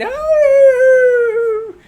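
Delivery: a very high, very loud drawn-out "Oh"; crowd howl. Acted. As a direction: howl it like a drunken bar crowd. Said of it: "they all begin".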